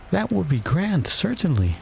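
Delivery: put-on high-pitched, musing young heroine; thoughtful. A narrator's reading. Alice considering an early dinner, thoughtful. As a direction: muse on it in a high voice. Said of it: "said Alice thoughtfully".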